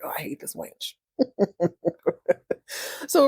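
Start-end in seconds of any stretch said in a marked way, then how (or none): 0.0-0.9 hushed, breathy aside; whispered thought. Retold or imitated. Richard glaring at Pam, whispering his hatred. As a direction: mutter it under your breath. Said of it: "staring at her like".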